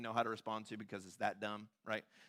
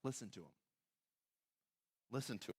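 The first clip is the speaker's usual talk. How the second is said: soft, understated command; the Father's message; 9 dB quieter than usual. Acted. God the Father telling believers to listen, hushed. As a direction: drop the volume, let it land gently. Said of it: "from the Father who says".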